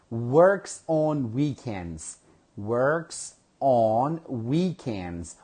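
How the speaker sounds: very slow, word by word, like dictating; slow dictation. Shown as a demonstration. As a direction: stretch every word so learners catch it. Teacher reads the item to class.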